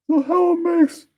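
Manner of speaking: squeaky high voice. Acted. High far voice.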